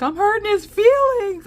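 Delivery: put-on high, loud kid wail; mock distraught child. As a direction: wail it like an upset little kid.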